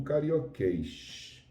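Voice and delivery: "carioquês" ending in a long sh sound; "carioquês" slow, with exaggerated Rio-style final s; Carioca S. Shown as a demonstration. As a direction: drag the end of "carioquês" into a hushing sh.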